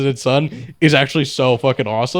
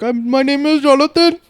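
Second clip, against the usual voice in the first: higher-pitched imitation of an Indian accent; mock Indian accent; 12 semitones higher than usual. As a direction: imitate a call-center scammer with an Indian accent. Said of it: "significant Indian accent".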